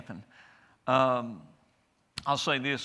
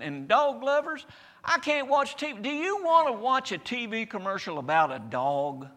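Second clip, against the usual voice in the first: fiery preaching; 8 semitones higher, 4 dB louder than usual. Acted. Preacher building to full force.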